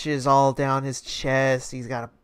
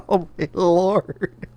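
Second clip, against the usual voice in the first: usual speaking voice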